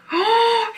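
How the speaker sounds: sharp high delighted gasp; squeaky surprised intake of breath; excited gasp. Real, not acted. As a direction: gasp in happy shock. Sees unicorn on tweezers.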